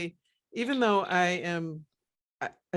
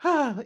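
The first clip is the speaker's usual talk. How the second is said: acted-out sigh after a laugh; contented sigh; 8 semitones higher, 4 dB louder than usual. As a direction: let out a high, drawn-out "ah" of release. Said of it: "I can feel myself after a laugh".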